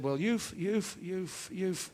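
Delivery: comic stammer, stuck repeating "you've". Acted. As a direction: stammer on one word, pitch rising. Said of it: "Freddie stammered a bit".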